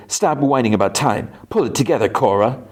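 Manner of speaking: a hint of cartoon villain. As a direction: lightly act a two-dimensional villain giving orders. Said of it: "like a two-dimensional villain".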